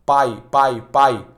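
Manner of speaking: PAI repeated in low pitch, all through the mouth; oral diphthong. Shown as a demonstration. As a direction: keep the nose out of PAI. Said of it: "Tu não tá usando o nariz".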